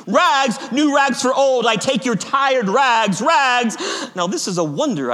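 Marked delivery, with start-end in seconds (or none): 0.1-3.8 high, loud ragman's street cry; ringing tenor vendor call; clear calling out. A narrator's reading. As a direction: hawk it down the alleys in high, drawn-out calls. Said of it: "calling in a clear tenor voice".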